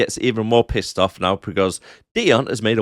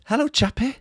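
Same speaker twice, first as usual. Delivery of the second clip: put-on baby talk. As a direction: coo it like to an infant. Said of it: "like a little baby".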